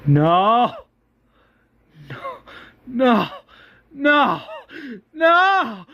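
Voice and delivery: rising sobbing cry of no; anguished no. Acted. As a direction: break down as the no's rise to a wail. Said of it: "and Dale's like".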